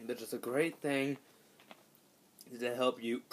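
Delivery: normal talking voice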